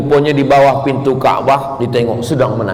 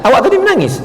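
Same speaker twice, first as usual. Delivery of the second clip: curious question; 11 semitones higher, much faster than usual. Retold or imitated. Congregants asking each other.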